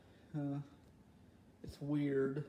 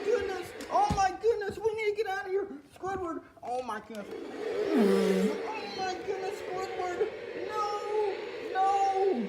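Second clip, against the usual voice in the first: very high, loud screams to flee; panicked screaming; 6 dB louder than usual. Acted. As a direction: scream for Squidward to run. Fleeing, screaming for Squidward.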